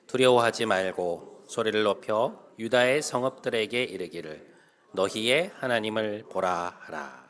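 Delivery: loud, measured congregational reading; scripture reading aloud. Real, not acted. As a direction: lead the congregation through the verse, projecting firmly. (크고 또박또박한 성경 봉독)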